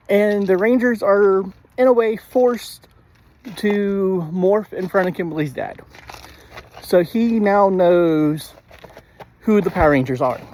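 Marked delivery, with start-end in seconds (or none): none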